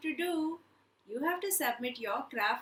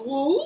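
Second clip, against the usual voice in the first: wolf howl; 10 dB louder than usual. Retold or imitated. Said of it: "The wolf will go".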